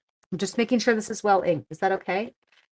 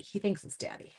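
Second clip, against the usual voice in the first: whisper; 8 dB quieter than usual. Real, not acted. Aside about the dog.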